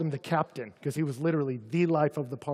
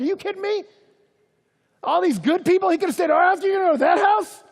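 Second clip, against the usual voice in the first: much higher, loud mock outrage; indignant grumbling; 10 dB louder, faster than usual. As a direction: whine like offended church folk. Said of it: "It says they all started grumbling".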